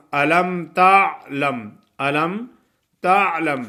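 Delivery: تعلم with a clear ain and a short تَ; correct ain. Shown as a demonstration. Teacher to students: correct model, clear ain. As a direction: recite تعلم slowly, stressing the ain. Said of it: "تا عین زبر تا".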